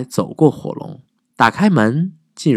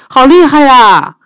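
high, loud amazed cry; shouting praise; 6 dB louder than usual. Acted. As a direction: cheer him on. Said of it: "伊丽莎白喊着".

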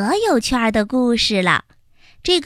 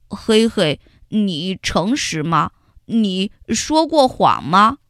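lower, earnest question; probing ant; 4 semitones lower than usual. Acted. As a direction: ask him straight out. Xiaoyi asks Heihei. (小易认真地追问)

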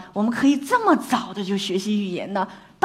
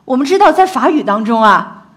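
ordinary talk

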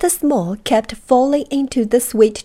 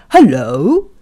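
lower, louder postman voice; postman's voice; 3 semitones lower, 9 dB louder than usual. A narrator's reading. Postman greeting a tiny man, louder and lower. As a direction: boom it down like a big postman.

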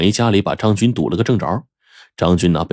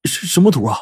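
much higher, bewildered question; puzzled underling; 10 semitones higher than usual. Acted. Zhang Jun, confused, asks boss Laowai which map he means. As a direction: sound baffled and innocent. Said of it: "张军不解".

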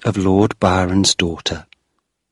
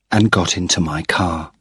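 plain reading voice

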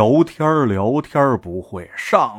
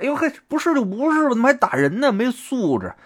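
high, quick aggrieved complaint; indignant protest; 12 semitones higher, faster than usual. A narrator's reading. Slapped man complaining about the stranger who hit him, indignant. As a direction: yelp in offence, pitched well up.

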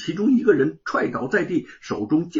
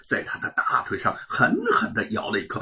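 usual speaking voice

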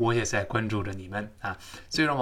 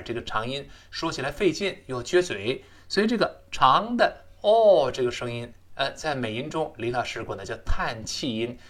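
ordinary talk

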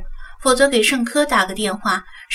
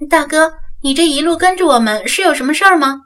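put-on higher, louder young woman feigning politeness; ingratiating question; 5 semitones higher, 6 dB louder than usual. A narrator's reading. Young woman to the man tailing her, ingratiatingly. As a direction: ask it with a forced, placating politeness. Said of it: "讪笑着低声问道".

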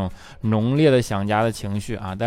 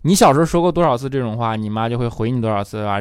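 ordinary talk, nothing marked

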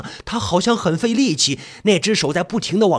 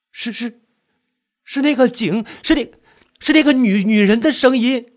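raised, halting, scared stutter; frightened stammer; 4 semitones higher than usual. Acted. Narrator recognising the woman's voice, scared.

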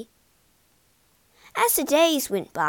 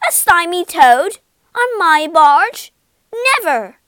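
high, very loud shriek of disgust; screamed outrage; 6 semitones higher, 12 dB louder than usual. A narrator's reading. Barge woman finding a toad aboard, screaming high. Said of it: "The barge woman screamed".

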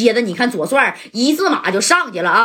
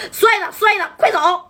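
much higher, urgent yell of a name; urgent shout; 9 semitones higher than usual. Retold or imitated. Li Zhengguang yells at his friend to flee, high urgent shout. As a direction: call out to get him to run. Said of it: "李正光就喊了".

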